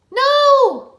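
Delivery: very high, loud cry; shout. A narrator's reading. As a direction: cry out in alarm. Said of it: "the ninja shouted".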